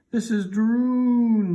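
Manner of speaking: high, loud and very slow proclamation; cried out. A narrator's reading. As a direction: draw the words out as a loud cry. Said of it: "Neil cried".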